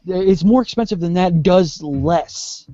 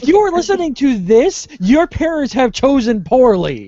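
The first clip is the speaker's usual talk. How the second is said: high, emphatic mock scolding; mock admonishment; 8 semitones higher than usual. Host addressing any child listeners, mock admonishment.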